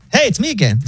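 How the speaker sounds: high, casual robot voice; mock the bot's breezy greeting; imitating the AI, high and friendly; chill AI voice. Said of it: "weirdly chill".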